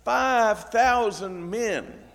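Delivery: normal talking voice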